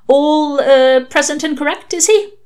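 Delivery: haltingly rising, lightly acted enquiry; nervous new father. A narrator's reading. Father checking baby is all right, hesitant question.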